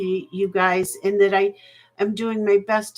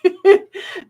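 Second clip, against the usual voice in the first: bright laugh; 10 semitones higher, 7 dB louder than usual. Real, not acted. Laughs at her own line.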